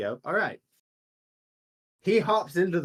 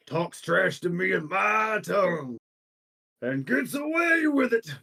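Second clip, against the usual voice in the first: rising into a shouted threat; angry threat; 5 semitones higher than usual. Acted. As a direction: build to a furious shout on the last words. Angry guild master threatens in quote.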